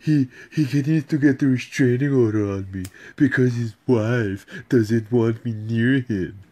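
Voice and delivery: lightly voiced self-important woman, slow at the end; hammed-up mimic of her self-importance; mocking the woman. Man imitating the obsessed woman, mildly mocking. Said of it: "She's like".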